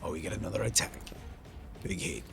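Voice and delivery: ordinary delivery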